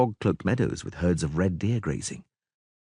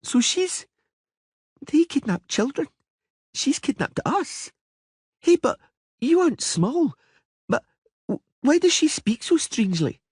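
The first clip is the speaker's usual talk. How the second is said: put-on high girlish voice, stubborn and petulant; a spoiled little princess making her case; young girl Ciri; 4 dB louder than usual. A little princess arguing with the Witcher, high girlish voice. Ciri asks about dryad.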